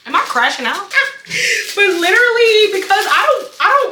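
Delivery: high burst of laughter at herself; laughing. Real, not acted. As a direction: laugh it off, giggling.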